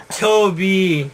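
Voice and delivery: light mock Italian call of the name; heavy Italian accent. Dying hedgehog to puppy, heavy Italian accent. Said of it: "something in a heavy Italian accent".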